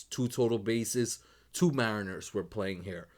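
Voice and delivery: ordinary delivery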